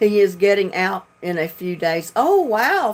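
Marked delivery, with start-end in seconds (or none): 2.1-2.9 a high, bright oh wow; pleasantly surprised. Real, not acted. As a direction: react to the good news with delight. Hearing good news.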